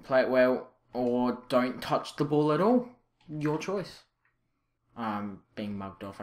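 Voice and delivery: normal talking voice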